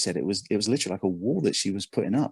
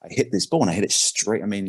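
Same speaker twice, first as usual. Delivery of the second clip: ordinary delivery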